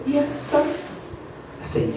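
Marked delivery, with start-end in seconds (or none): 0.1-0.6 put-on high, timid little boy's yes sir. Boy answering girlfriend's dad, high timid voice. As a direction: shrink it down like a scared kid.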